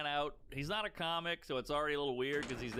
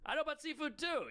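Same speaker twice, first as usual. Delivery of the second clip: sarcastic retort; 10 semitones higher than usual. Retold or imitated. Sarcastic reply to bragger.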